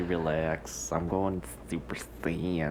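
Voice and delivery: soft, slow, voiceless whisper; hushed whisper. Pretend. Friend jokes about powering up after the rant, slow whisper.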